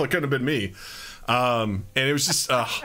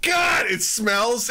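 slow disgusted groan; 6 semitones higher, much slower than usual. Retold or imitated. Disgusted at fart smell.

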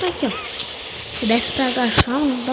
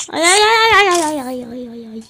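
pained cries; 3 semitones higher, 4 dB louder than usual. Real, not acted. Gamer yelping after a fall, then fading. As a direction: cry out in pain as you land. Falls in game, hurts foot.

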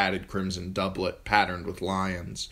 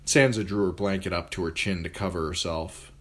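ordinary delivery, nothing marked

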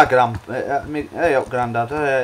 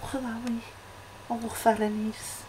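high, soft mock Scouse; tiny high-pitched put-on Scouse voice; bad Liverpool accent; 11 semitones higher, 7 dB quieter than usual. Shown as a demonstration. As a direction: squeak it quietly in a fake Liverpool accent. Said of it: "Liverpool accent".

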